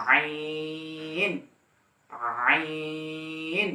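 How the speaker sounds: আইন drawn out slowly from the middle of the throat; Arabic letter ain. Shown as a demonstration. Teacher demonstrating আইন from mid-throat. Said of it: "আইন উচ্চারণ হবে".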